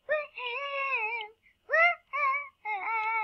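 high, drawn-out whoop; gleeful woohoo. Real, not acted. Player cheering at walking on water, high gleeful whoop. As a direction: whoop high and long in delight.

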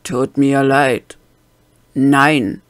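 loud, blunt fake apology; sorry without a shred of regret, flat refusal; unapologetic. A narrator's reading. The gunman refuses to stand down, loud and blunt. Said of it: "so bedauernd wie eine Abrissbirne im Anflug".

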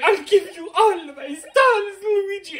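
high, drawn-out crying call; wailing Mario. Retold or imitated. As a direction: wail the name in hammed-up despair. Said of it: "he's just like".

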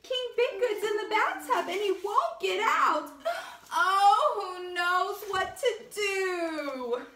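high, wailing servant voice; panicky page. A narrator's reading. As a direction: fret and wail like a worried page. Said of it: "cried the page".

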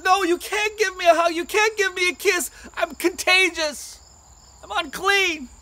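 high, insistent warning; acted distress, pitched high; urgent refusal. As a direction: desperately keep a loved one back. David refuses wife's hug.

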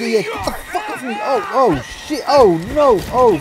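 high, loud shrieks of real panic; panicked screaming. Real, not acted. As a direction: scream as if fighting off an attacker. Attacked in horror game.

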